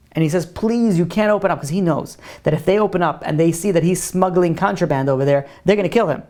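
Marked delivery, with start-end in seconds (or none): none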